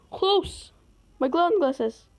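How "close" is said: high excited cry; high surprised close. Real, not acted. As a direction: yelp at a near miss. Near miss.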